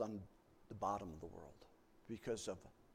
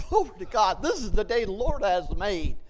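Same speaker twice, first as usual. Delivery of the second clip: louder, laughing praise; joyful loud praise; 5 semitones higher, 14 dB louder than usual. Retold or imitated. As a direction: laugh out your praise to God. Jumping with joy in car.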